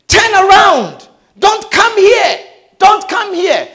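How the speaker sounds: high shouted warning; warning voices. Retold or imitated. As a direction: shout him back from the pit, urgent. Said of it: "I could hear".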